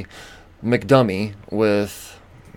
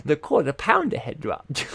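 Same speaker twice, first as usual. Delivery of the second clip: higher, incredulous mocking repeat; mocking echo; 6 semitones higher, 3 dB quieter than usual. Mocking the cashier's excuse to his viewers. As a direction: parrot the excuse with scorn.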